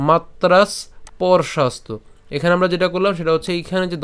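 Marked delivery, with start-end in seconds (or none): 0.1-1.9 "মাত্রা স্পর্শাস্তু" slowly, as matras, then porshastu; pronunciation demonstration. Shown as a demonstration. Teacher showing learners how to split it, "মাত্রা স্পর্শাস্তু" slowly as matras, porshastu. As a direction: say "মাত্রা স্পর্শাস্তু" slowly, closing the first word on s. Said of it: "খেয়াল করুন উচ্চারণ কেমন করে করছি".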